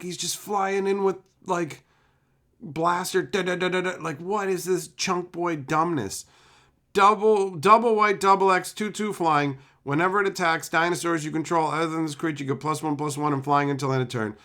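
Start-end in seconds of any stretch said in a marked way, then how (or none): none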